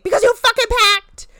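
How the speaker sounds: explosive shout, very high and loud; yelled as one word. Retold or imitated. Retelling an angry woman snapping back at a calm man, high loud shout. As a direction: yell it all run together as a single word. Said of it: "She, like, makes it one word".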